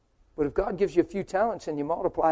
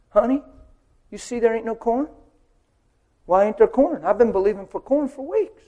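complaining husband; 5 semitones higher, 5 dB louder than usual. Acted.

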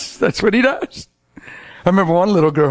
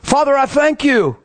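high, projected retold praying; yelled prayer; 9 semitones higher than usual. Man praying for driver in another lane, yelling. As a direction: call out a prayer across traffic. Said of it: "Yelling across the lane".

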